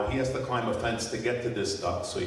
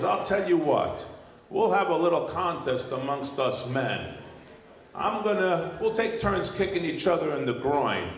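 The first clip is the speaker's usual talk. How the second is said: raised-pitch farmer making a challenge; burly farmer; 5 semitones higher than usual. Acted. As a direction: propose a contest as the farmer. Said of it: "big, burly farmer".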